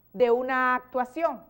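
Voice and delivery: usual speaking voice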